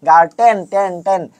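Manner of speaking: "গার্টেন" with a hard t, then "টেন" repeated; "গার্টেন" said gar-TEN, echoing the last syllable; stressed hard TEN. Shown as a demonstration. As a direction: drill the "টেন" ending with a hard t. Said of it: "টেনটা important".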